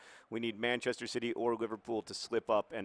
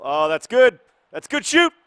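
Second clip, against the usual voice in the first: much louder, much higher yell of delight; excited shout; 22 dB louder, slower than usual. Real, not acted. Manager cheering a goal live. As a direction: erupt as the shot goes in.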